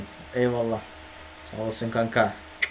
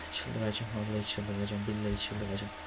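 much softer, grumbling under the breath; muttered frustration; 4 semitones lower, 12 dB quieter than usual. Real, not acted. Streamer muttering to himself over his broken setup, softer and grumbling. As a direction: mutter it to yourself, at the end of your rope.

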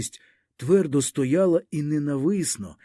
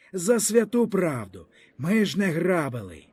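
usual speaking voice

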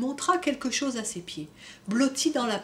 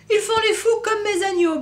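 much higher, louder bleating voice; sheep bleating; 10 semitones higher, 7 dB louder than usual. Acted. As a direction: bleat it like a lamb. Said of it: "bêla Noémie". (bêlement de brebis, plus aigu)

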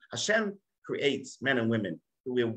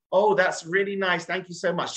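sweet wife voice; 8 semitones higher than usual. Retold or imitated.

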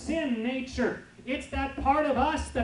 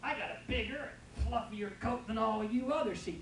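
smug sheep; 7 dB quieter than usual. Retold or imitated. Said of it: "the sheep thinks it's pretty good".

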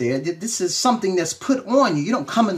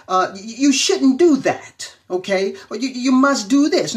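higher, louder scolding parent voice; stern parent; 6 semitones higher, 4 dB louder than usual. Retold or imitated. Pastor imitating parents telling a child what to do, scolding and higher. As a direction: voice a parent laying down the rules. Parents' should messages quoted.

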